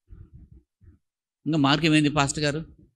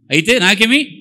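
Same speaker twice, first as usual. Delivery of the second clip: defiant retort; 6 semitones higher, 7 dB louder than usual. Retold or imitated.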